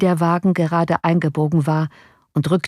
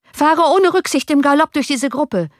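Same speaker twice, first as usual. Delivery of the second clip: high, loud shouted order; shouting; 9 semitones higher, 3 dB louder than usual. A narrator's reading. Johann shouting at the coachman to gallop through the crowd. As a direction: bark the order over the clatter of the coach. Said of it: "schrie Johann dem Kutscher zu".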